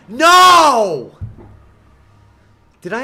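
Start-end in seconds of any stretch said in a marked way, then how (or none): 0.1-1.2 much louder and higher shout; anguished shout. Real, not acted. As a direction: scream it as it all fails. Attempt fails at the end.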